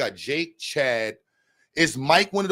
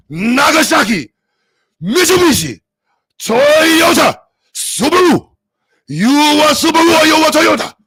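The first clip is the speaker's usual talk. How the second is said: put-on high, shouted caricature Japanese accent; angry mock Japanese accent; 13 semitones higher, 9 dB louder than usual. Streamer demonstrating anger in the accent, shouted mock Japanese. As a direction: bellow car names in exaggerated Japanese-accent anger. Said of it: "Japanese people just have anger put with their accent".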